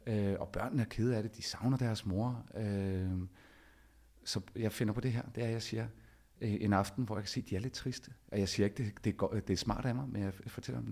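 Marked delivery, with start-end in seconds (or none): none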